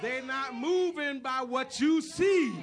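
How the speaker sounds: very loud, slow, hammered-out declaration; full-force preaching. Acted. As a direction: shout each word to the congregation, drawn out.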